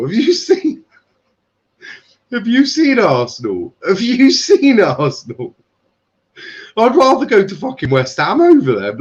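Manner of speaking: high-pitched, scoffing disbelief; incredulous mockery. Real, not acted. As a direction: voice shooting up as if it's absurd. Mocking Arsenal rumour in mock disbelief.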